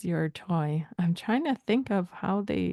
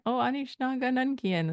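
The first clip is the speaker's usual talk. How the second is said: higher-pitched, casual question; lightly chatty, raised pitch; casual and curious; 6 semitones higher than usual. Shown as a demonstration. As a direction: ask it offhand, like checking in. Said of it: "that's kinda how it sounds to me".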